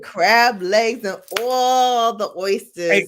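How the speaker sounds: put-on drawn-out teasing country accent; mock country accent. Teasing the man about his oyster order, mock country accent. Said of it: "she be clowning my country accent".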